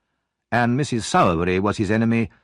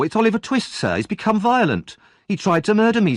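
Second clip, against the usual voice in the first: fake distressed boy; 12 semitones higher than usual. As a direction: play the victim, tearful and frantic. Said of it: "Noah cried".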